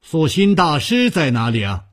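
urgent loud inquiry; calling out loudly. A narrator's reading. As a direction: call the question across the hall. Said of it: "他大声地说".

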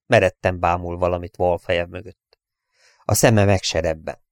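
usual speaking voice

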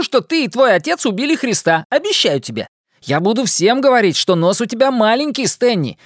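high, gleeful taunting; gleeful teasing. Acted. Richie gleefully teasing Stan.

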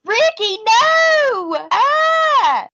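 high, drawn-out hammed-up shriek of panic; mock horrified scream. Mocking the girlfriend's reaction to the killing, hammed-up high shriek. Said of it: "and you just are like".

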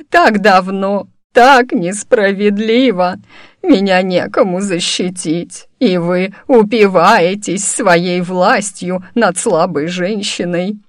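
slow, drawn-out acted self-pity; tearful lament. A narrator's reading. Old woman claiming nobody protects her, tearful lament. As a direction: plead like a wronged old woman on the verge of sobs. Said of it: "Она всхлипнула".

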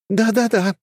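high, teasing yes-yes-yes; playful young women. Acted. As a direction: girls humoring him. Girls exchange glances.

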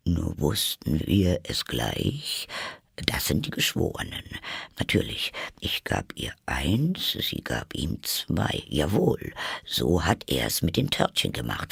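quiet, low muttered reading; reading softly. A narrator's reading. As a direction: read the verses under his breath. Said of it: "die Verse leise durchzulesen".

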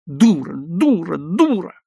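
hurt, helpless insult, drawn out; tearful outburst. A narrator's reading. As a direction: wail it through mock tears. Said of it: "и расплакался".

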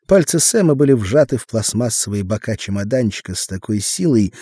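ordinary delivery, nothing marked

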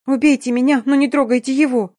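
high-pitched, impassioned begging; desperate plea. A narrator's reading. Girl offers her life for her husband's, high and desperate. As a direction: beg for him, voice pushed high. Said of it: "тут же прошипев".

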